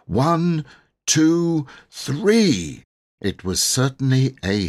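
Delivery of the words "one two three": high, loud count building; counting up to a yell. Acted. Boy counting the children in to a yell. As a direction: count them in to scream.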